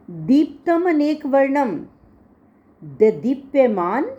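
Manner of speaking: ordinary talk, nothing marked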